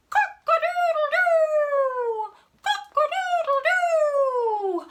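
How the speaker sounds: very high, loud, drawn-out crowing; rooster crow. Shown as a demonstration. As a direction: crow slowly like a big rooster. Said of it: "help me make the rooster sound again".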